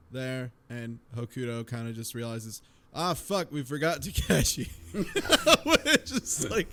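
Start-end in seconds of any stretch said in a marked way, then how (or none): none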